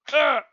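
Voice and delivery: loud frustrated ah; exasperated yell. Real, not acted. Vlogger at wits' end, exasperated yell. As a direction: let out a frustrated cry.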